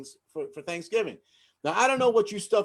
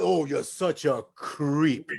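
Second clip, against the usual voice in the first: slow, drawn-out "creep"; insulting; 4 semitones lower, much slower than usual. Shown as a demonstration. Comic showing the worst insult, said slowly. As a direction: stretch "Oh, you're such a creep." slowly. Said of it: "You call".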